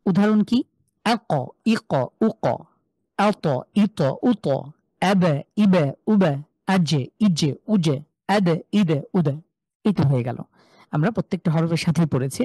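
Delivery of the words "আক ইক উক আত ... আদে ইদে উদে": echoing release on every ক, ত, ব, জ and দ; qalqalah echo. Shown as a demonstration. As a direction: bounce the last consonant of each, from আক, ইক, উক to আদে, ইদে, উদে. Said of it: "ইকো sound করে পড়বো".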